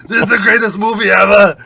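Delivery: high, loud Austrian accent imitation; hammed-up Austrian accent boast; Arnold impression. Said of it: "he's like".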